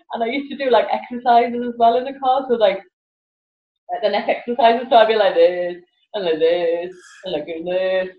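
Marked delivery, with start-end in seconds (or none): none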